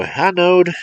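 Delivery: high, loud defiant cry; cried out. Acted. Boy admitting to the old man, crying out high. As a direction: blurt it out. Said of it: "cried the boy".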